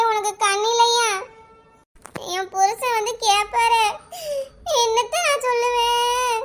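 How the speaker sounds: theatrical wail with drawn-out endings; hammed-up weeping; sobbing lament. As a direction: cry out to heaven in stagey grief. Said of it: "அழுதுகிட்டே கிடக்கா".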